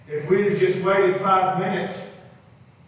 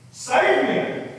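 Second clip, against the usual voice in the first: shouted cry; 5 dB louder than usual. Acted. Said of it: "he shouted".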